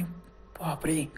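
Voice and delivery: very slow, near-whispered gasp of horror; hushed dread. A narrator's reading. Runner facing the monster's lit-up face, hushed dread. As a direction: let it out softly, drawn out, in dread.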